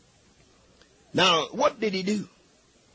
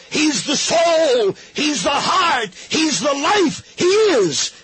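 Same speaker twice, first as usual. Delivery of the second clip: higher, loud preaching shout on each word; 6 semitones higher, 3 dB louder, slower than usual. Acted. Preacher proclaiming Christ to the congregation, shouting. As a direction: hurl each line up to the rafters.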